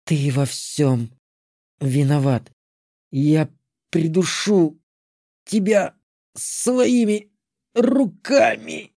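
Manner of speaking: slow hoarse rasp rising high; hoarse wheeze. Acted. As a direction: rasp it out as if strangled. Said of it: "прохрипела Алантри".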